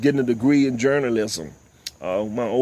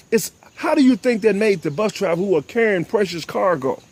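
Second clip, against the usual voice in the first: ordinary talk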